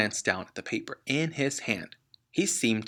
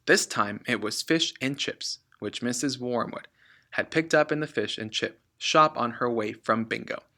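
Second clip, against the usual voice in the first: usual speaking voice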